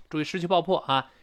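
usual speaking voice

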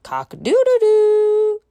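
high, loud, quick rooster crow. Pretend. Teacher crowing for the children like a rooster, high and loud. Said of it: "一位是公鸡，rooster".

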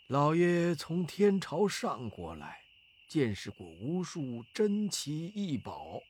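put-on soft, humble request from an old abbot. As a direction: play the deferential elderly monk.

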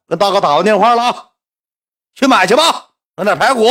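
high, loud holler; calling out. Retold or imitated. Husband sending wife to buy ribs, hollering.

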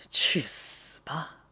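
slow, drawn-out whispered curse; hushed, malicious wish; sinister whisper. Acted. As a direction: whisper it slowly with menace. Secretly wishing classmate dead.

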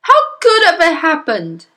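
high, loud, bewildered demand; yelling father. Acted. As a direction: yell it at the family. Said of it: "he yelled".

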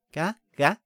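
loud mother duck quack. A narrator's reading. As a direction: call the brood with a quack. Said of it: "它叫道".

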